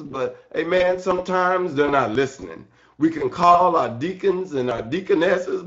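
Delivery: ordinary delivery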